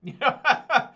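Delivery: a sudden loud laugh; loud burst of laughter. Real, not acted. Streamer laughing at his own advice, loud laugh.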